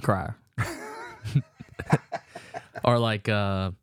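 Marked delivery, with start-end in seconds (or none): 0.0-0.3 Southern accent. Shown as a demonstration. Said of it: "do that crier thing again".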